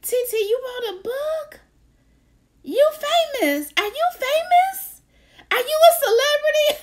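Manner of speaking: put-on high, awed little-girl voice; squealing child asking about fame; child niece voice. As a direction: play a wide-eyed ten-year-old. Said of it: "my niece, Gabrielle, said".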